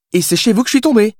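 put-on high-pitched voice of a fictional young woman; insistent Billy. A narrator's reading. Billy shows the writer proof in the book, insistent. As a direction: argue the point in a high character voice.

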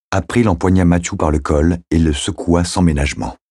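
usual speaking voice

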